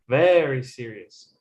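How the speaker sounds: VERY stretched slowly, dropping to a low finish; stress on VERY. Shown as a demonstration. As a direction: lean hard and slowly on Very. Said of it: "Pitch on the focus words".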